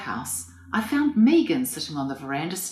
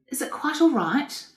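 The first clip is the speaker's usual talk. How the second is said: anxious question; 11 semitones higher than usual. A narrator's reading. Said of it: "asked Megan anxiously".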